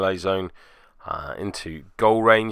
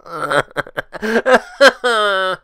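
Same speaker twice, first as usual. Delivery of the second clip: high laugh; 11 semitones higher, 5 dB louder than usual. Real, not acted. Laughs at own misplay.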